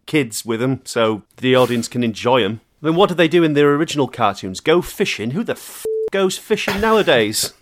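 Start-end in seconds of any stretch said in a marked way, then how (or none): 2.8-7.5 hammed-up exec, rising to a yell; mock exec rant. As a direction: rant like a clueless nineties exec. Mocking the Disney execs' reasoning.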